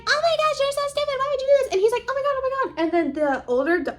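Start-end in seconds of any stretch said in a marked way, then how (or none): 0.0-1.7 very high-pitched mock tirade; hammed-up high scolding; angry mom. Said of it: "Oma is beating up her husband". 2.1-2.8 high-pitched mock panic; hammed-up high yelp of words; panicked husband. Husband being beaten, panicking.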